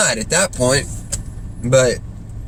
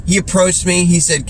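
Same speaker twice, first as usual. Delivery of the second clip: ordinary delivery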